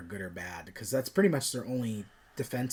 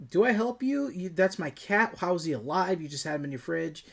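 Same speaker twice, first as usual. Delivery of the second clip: higher, hammed-up baffled questions; pitched-up mock disbelief that eases off; mock bewilderment; 7 semitones higher than usual. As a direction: sound thrown by the chaos, mock-incredulous. Dan confused during cat fight.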